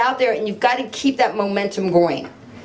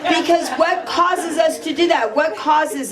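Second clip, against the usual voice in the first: laughing question; 6 semitones higher than usual. Real, not acted. Answering an audience member's remark with laughter and higher pitch. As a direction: keep laughing as you ask.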